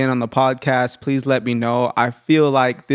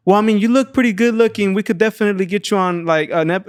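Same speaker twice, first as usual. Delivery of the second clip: higher-voiced imitation of a recruiter pitching; lightly mock a pushy recruiter, pitched up; recruiter's pitch; 7 semitones higher than usual. Driver pitching porn work to his passenger, higher-voiced imitation. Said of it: "He's throwing his pitch".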